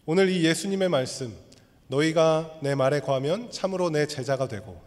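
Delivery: usual speaking voice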